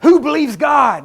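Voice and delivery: slow, high, shouted challenge to the crowd; imitating A. A. Allen. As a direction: holler it like a tent revivalist. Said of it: "he'd say".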